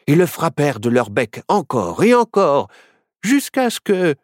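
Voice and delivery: usual speaking voice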